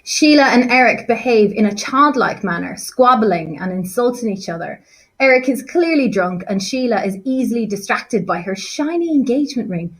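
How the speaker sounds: usual speaking voice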